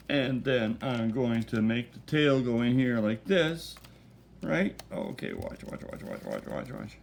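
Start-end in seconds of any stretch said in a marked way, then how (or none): 4.9-7.0 low hushed whisper. Real, not acted. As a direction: breathe the words under your breath. Hushed while placing the piece.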